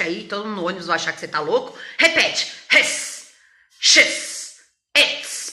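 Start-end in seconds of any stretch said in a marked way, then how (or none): 2.7-5.5 "His, she's, it's" in voiceless, hissed bursts; short separate puffs ending in a sharp s; sneeze-like contractions. Shown as a demonstration. Teacher leading a repeat drill, sneeze-like syllables. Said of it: "Parece que você tá até dando uma espirrada".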